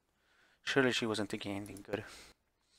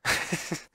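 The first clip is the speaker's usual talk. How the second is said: airy wicked chuckle; breathy evil laugh; 7 semitones higher than usual. Acted. Lillian savoring her revenge with a wicked laugh.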